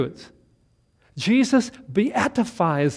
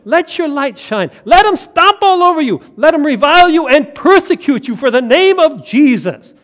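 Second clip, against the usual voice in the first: higher and louder, rousing the flock; fiery preaching; 7 semitones higher, 10 dB louder than usual. Acted. Pastor urging the congregation on, loud and fervent. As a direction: push it out with mounting fervour.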